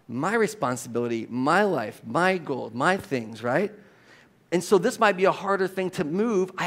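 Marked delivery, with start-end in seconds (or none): 0.1-3.4 low, measured list with weight on MY; individualistic list. Acted. Preacher lists how our culture thinks, stress on MY. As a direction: lean on "my" in each item, pitched low. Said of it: "tendency to think individualistically".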